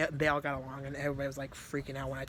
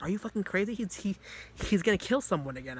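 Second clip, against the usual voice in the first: higher, sharper, outraged; alarmed scolding; 6 semitones higher, 4 dB louder than usual. Retold or imitated. Monica scolding the speaker for letting Vice in, outraged. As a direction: tell him off like he's lost it.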